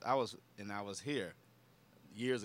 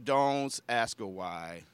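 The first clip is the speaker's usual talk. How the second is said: wary inner voice; 6 semitones lower, 4 dB quieter, much slower than usual. Retold or imitated. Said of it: "in my mind, I'm going".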